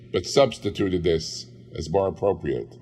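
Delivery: ordinary delivery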